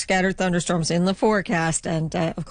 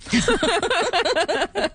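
laugh; 11 semitones higher than usual. Real, not acted. Laughing with mouth full.

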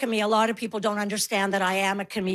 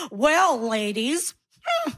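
slow, teasing drawn-out flirtation; mock flirting; 3 semitones higher, much slower than usual. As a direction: imitate a man laying on patronizing flirtation. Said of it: "then they do a flirt".

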